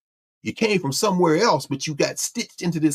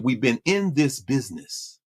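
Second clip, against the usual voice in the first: slow, lightly voiced old Southern man; old Southern drawl; 4 semitones lower, slower than usual. Lawyer Fred Gray recalls years in civil rights, slow mild Southern drawl. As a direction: ease it out like an old Southern elder. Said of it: "I love the way Fred, Fred Gray".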